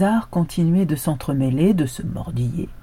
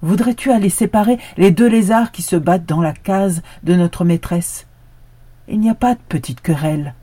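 pleading dog; 3 semitones higher, 7 dB louder than usual. A narrator's reading. Dog asks the goat.

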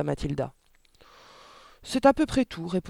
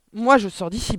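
raised, loud, mildly panicked; spluttered in fear; 6 semitones higher, 8 dB louder than usual. A narrator's reading. Father fleeing the supposed ghost, flustered splutter. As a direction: announce a flustered escape. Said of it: "bafouilla le père".

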